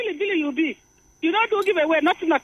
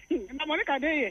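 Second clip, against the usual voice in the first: laughing; 9 dB quieter than usual. Real, not acted. Caller laughs greeting the host.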